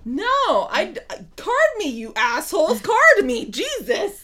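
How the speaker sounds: high, loud, laughing mock outrage; mock indignant yell. Woman retells wishing the waiters would card her, mock yell. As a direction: demand to be carded like it's an insult. Yelling at bar staff for carding.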